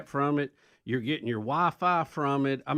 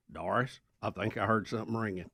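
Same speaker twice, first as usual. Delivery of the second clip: put-on low, quiet deep voice of an old man; imitating a deep-voiced father in the car; deep-voiced dad; 6 semitones lower, 7 dB quieter than usual. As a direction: drop into a low rumbling dad voice. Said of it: "who had a very deep voice".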